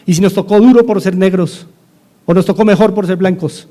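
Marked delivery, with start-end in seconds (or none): none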